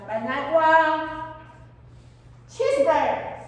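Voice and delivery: loud, slow, craving cheeseburger; eager want. Shown as a demonstration. Teacher acting out a craving for students, loud and slow. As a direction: stretch out the want like a hungry kid.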